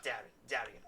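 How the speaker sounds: ordinary talk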